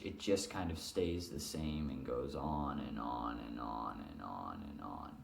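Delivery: low, fading, one-note drone; droning monotone. Shown as a demonstration. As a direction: let it sink and drone on and on. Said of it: "Monotone is your voice doesn't change pitch".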